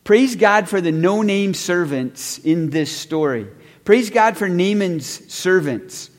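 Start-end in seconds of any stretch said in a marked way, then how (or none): none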